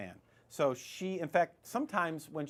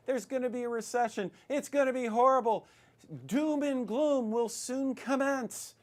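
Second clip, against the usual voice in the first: mock doom; 7 semitones higher, 3 dB louder than usual. Mocking experts' doom predictions.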